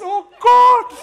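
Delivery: high loud laughing Oh God; laughing cry. Retold or imitated. Pastor retelling his overwhelmed moment on stage, laughing loudly. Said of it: "I just".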